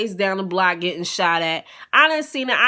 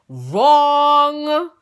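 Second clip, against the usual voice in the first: Wrong said high and loud with a rising, laughing tone; emphatic wrong; 7 semitones higher, 8 dB louder than usual. Shown as a demonstration. Teller correcting herself to the audience, loud and high. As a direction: belt out Wrong as the punchline.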